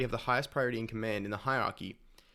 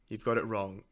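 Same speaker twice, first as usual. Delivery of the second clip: usual speaking voice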